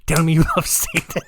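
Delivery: mock killer breaking into laughter; laughing. Killer's demand to profess love to Satan, dissolving into laughter. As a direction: start the threat, then crack up on the last word. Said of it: "I hate that I'm laughing".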